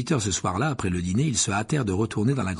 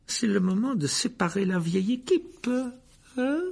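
mocking, higher teasing tone; sneering; 10 semitones higher than usual. A narrator's reading. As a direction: needle the old friends. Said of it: "d'un air narquois".

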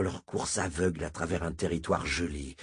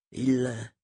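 hesitant start, pitched up and left hanging; raised, suspended hesitation; 6 semitones higher than usual. A narrator's reading. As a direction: break off after the first word, unsure how to go on. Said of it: "Wolf hésita".

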